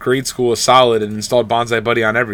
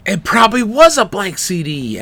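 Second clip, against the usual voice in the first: mock high voice; 9 semitones higher than usual. Mocking, high far voice.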